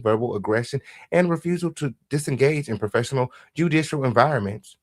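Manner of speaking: ordinary talk, nothing marked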